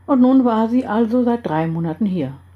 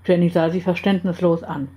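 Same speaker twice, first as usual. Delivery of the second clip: plain reading voice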